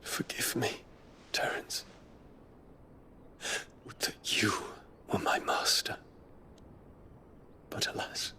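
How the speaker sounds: breathy whisper, voice catching on a sob; a pained whisper of regret; whispered confession. Acted. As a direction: confess it under your breath, barely holding back tears. Private anguished confession to himself.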